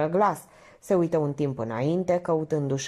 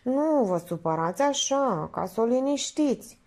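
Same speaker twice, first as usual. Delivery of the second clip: high, hesitant woman's voice; naive, coy Minerva; 8 semitones higher than usual. Acted. Minerva suggesting he visited Elena, coyly placating. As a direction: stammer the start, then placate.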